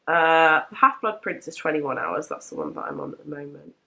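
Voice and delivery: ordinary talk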